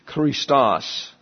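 Christos with the stress on "-tos"; stress on second syllable. Shown as a demonstration. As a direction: say "Christos", leaning on the final syllable. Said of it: "accent on the second syllable".

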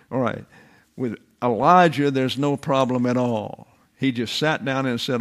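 ordinary delivery

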